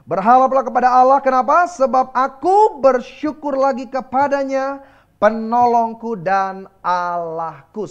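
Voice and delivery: loud, fervent reading of the Psalm; proclaimed scripture. Acted. Preacher proclaiming the Psalm to the congregation, loud and fervent. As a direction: call the verse out to the congregation.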